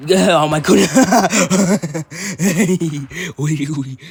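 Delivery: loud, high burst of real laughter; laughing hard. Real, not acted. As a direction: crack up at your own joke. Bursts out laughing at own joke.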